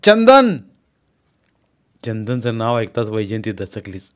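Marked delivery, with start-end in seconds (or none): 0.0-0.6 loud, high shout of relief; shouted name. Acted. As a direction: yell to a familiar voice. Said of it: "गाडीवान ओरडला".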